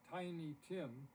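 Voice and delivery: ordinary delivery, nothing marked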